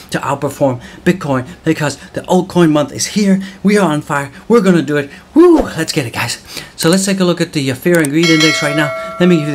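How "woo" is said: cheering whoop; excited woo. Real, not acted. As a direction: let out a hyped woo. Cheering over rising prices.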